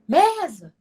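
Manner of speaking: "mesa" called out high with a long first syllable; calling out. Shown as a demonstration. Teacher shows students how to shout for the word mesa, high calling voice. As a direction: shout for the word, pitch leaping on "me". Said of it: "Quando você grita pela palavra mesa".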